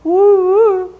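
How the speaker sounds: high whooping bark; excited dog woo. Retold or imitated. Dog running to the front of the run, high whoop. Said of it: "and going".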